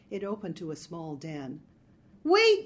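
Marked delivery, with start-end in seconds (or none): 2.2-2.7 high, loud yell to stop; sharp high shout of alarm; urgent yell. A narrator's reading. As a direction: yell after someone running off. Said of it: "yelled Marge".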